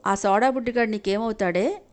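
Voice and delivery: plain reading voice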